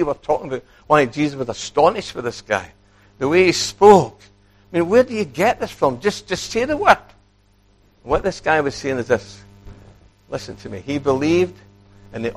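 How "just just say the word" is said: pleading centurion. Acted. Repeats centurion's plea.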